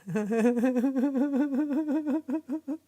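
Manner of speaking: long, high, rapid giggling laugh. Real, not acted. Streamer cracking up on a live stream, real high laughter. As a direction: burst out in a loud, high-pitched laugh.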